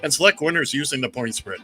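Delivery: booming game-show announcer over music; radio show announcer. Acted. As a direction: sell the segment like a big radio opener. Opens show segment over music.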